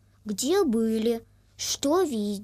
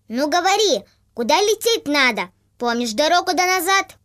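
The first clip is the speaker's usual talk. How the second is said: loud, demanding goose leader; bossy goose leader; 4 semitones higher, 7 dB louder than usual. Acted. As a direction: quiz him like a strict teacher. Leader goose quizzes the boy.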